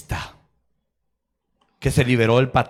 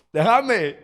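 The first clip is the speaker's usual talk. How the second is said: high, raised mock cry; retold upset rebuff; 9 semitones higher, 4 dB louder than usual. Preacher retells rebuffing his colleague, mock upset. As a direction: push them away with a pitched-up protest. Retold self refusing the hug.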